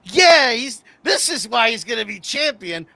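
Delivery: high, loud burst of fan excitement; excited fan reaction. Retold or imitated. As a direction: exclaim it like a thrilled fan. Acting out own excited fan reaction.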